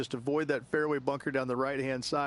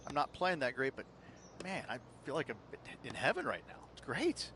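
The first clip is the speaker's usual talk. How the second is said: softer, hushed and quieter; hushed inner thought; 8 dB quieter than usual. Retold or imitated. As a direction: say it quietly, to yourself. Golfer voicing his own thoughts.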